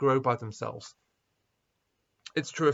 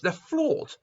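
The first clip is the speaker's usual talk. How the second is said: ordinary talk, nothing marked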